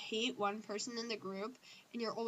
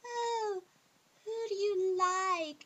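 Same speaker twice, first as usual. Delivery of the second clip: high, slow singsong tease; much higher, slow mock-teasing singsong; mock teasing question; 10 semitones higher, 5 dB louder, much slower than usual. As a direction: tease it like a nosy friend. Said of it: "all the other times it's like".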